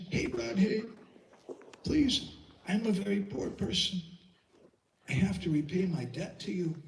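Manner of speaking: high, begging voice of a weeping Krishna; Krishna begging Radha, high tearful plea; Krishna pleading. A narrator's reading. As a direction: plead with her, voice lifted high and near tears. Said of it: "Krishna was crying".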